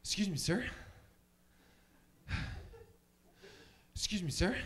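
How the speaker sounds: breathless movie-hero impression, questioning lift at the end; Mark Wahlberg. Comic imitates an actor accosting someone, winded impression. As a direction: repeat it like a baffled, winded actor. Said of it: "here's my impression of Mark Wahlberg".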